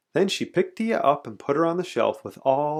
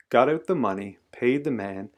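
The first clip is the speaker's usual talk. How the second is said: ordinary delivery, nothing marked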